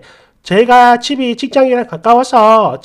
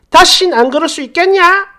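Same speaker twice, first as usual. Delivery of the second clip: higher, louder scolding question; mock angry demand; 6 semitones higher, 3 dB louder than usual. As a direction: throw the ultimatum at her. Mother-in-law threatening.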